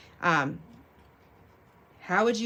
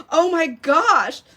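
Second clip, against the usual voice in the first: mock shock; 10 semitones higher, 10 dB louder, much slower than usual.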